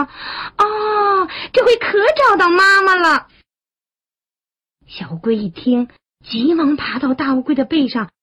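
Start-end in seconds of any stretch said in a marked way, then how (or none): 0.0-3.3 put-on high, excited childlike cheer; overjoyed. Tadpoles think the turtle is mother, high delight. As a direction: burst out in a high little voice, getting louder.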